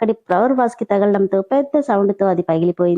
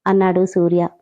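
ordinary delivery

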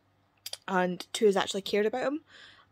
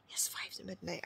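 whispered, much softer; 2 semitones lower, 11 dB quieter than usual. Real, not acted. Vlogger to viewers late at night, whispered. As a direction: whisper the time.

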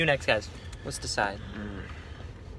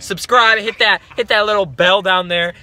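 youtuber outro; 7 semitones higher, 9 dB louder than usual. Acted. Vlog outro host voice.

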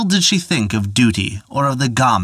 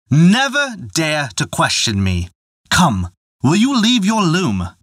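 higher, insistent father's order; angry command; 6 semitones higher than usual. Acted. Father ordering his daughter to leave her loom, angry. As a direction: brook no question, demand it.

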